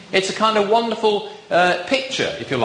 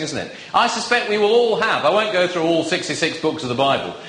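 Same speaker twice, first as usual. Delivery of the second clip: usual speaking voice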